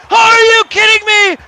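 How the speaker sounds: yelled high and loud; shouted disbelief. Pretend.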